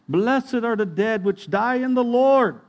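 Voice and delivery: loud, slow, high preaching proclamation. Acted. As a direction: proclaim the verse from the pulpit, slowly. Preaching scripture at a funeral.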